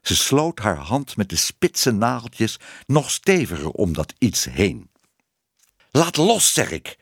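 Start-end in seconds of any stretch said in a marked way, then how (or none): 5.9-7.0 raised first words, dropping to a firm finish; firm command. A narrator's reading. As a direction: order it sternly, short of a scream. Tibbe orders Minoes again. (streng, dringend bevel)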